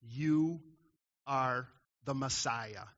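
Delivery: slow, word by word, with pauses; just the facts. Retold or imitated. Preacher shows how brief Peter's answer is, matter-of-fact and spaced out. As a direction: set each word down separately as a bare fact. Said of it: "it's just the facts".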